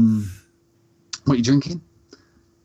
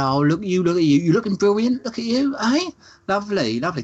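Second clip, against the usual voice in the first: put-on much higher, gushing flattery from an old lady; imitating Nan Peggy; 8 semitones higher than usual. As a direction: lay the compliments on thick, sweetly. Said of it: "She said".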